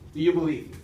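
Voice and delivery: usual speaking voice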